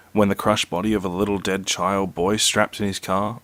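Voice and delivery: ordinary delivery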